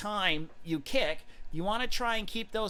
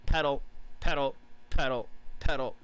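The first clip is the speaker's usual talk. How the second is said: lower, chopped-up pedal beats; choppy pedal pedal; 4 semitones lower than usual. Shown as a demonstration. Coach mimicking uneven pedalling, choppy beats. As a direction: stamp out each pedal stroke. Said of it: "we don't wanna go pedal".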